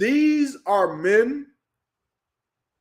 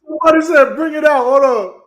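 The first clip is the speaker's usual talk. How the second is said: loud, raised-pitch insistence; incredulous; 4 semitones higher, 6 dB louder than usual. Real, not acted. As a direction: insist loudly in amazed disbelief. Insisting on what was heard.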